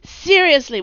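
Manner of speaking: high, loud excited burst; bursting with excitement; gleeful. Acted. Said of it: "Zed crowed".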